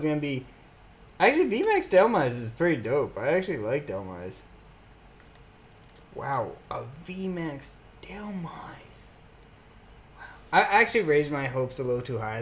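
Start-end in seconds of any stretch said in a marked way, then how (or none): 6.1-10.2 slow, hushed near-whisper; not too excited. Real, not acted. Card opener who raised hopes for Charizard, hushed letdown. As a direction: let the wow out softly, underwhelmed. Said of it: "I don't sound too excited".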